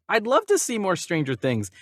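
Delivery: ordinary talk, nothing marked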